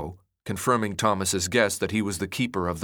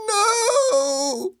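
long, very high, terrified scream; anguished drawn-out wail; 4 dB louder than usual. A narrator's reading. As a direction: scream in desperate refusal. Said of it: "Ben screamed".

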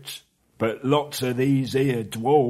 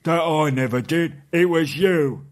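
higher, much louder denial; troll blaming his mate; indignant troll; 9 semitones higher, 8 dB louder than usual. A narrator's reading. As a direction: deny it hotly like a troll. Trolls quarrelling.

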